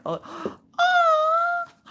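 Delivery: high, thrilled gasping oh; overjoyed at the gift; delighted surprise. Real, not acted. Finds new items in the box.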